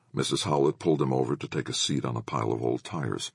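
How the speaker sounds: plain reading voice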